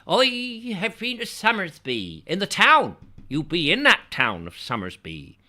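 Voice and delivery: high rural voice, oi for I; rustic dialect. Pretend. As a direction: play a village local proud of the town. Mock rural local voice.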